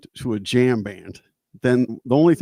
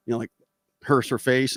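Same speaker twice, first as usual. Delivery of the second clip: ordinary talk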